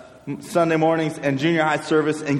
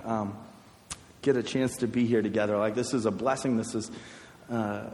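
a soft, hushed prayer voice; a youth pastor praying quietly for his students; 6 semitones lower, 8 dB quieter than usual. Acted. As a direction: pray quietly over the students. Leads the group in prayer.

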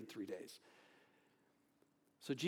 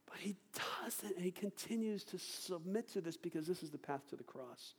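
whisper; 3 semitones higher, 6 dB quieter than usual. Real, not acted. Hushed turn after the shouting.

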